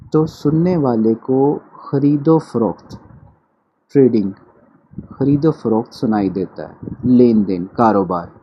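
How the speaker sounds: ordinary talk, nothing marked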